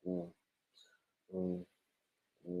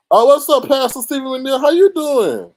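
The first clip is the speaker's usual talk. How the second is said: excited greeting; 9 semitones higher, 9 dB louder than usual. Real, not acted. Greeting pastor in chat.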